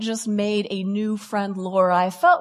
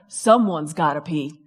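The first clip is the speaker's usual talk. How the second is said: ordinary talk, nothing marked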